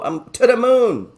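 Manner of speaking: high, loud, drawn-out cheer; to the moon. Real, not acted. Trader bragging his Bitcoin long, excited slow cheer. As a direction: crow it with greedy glee.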